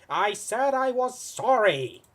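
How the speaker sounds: loud, high and slow, stressing said; wounded protest, raising the voice; exasperated villain. Acted. Villain protests to the hero, exasperated and loud.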